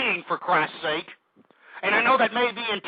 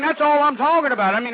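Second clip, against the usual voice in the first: ordinary talk, nothing marked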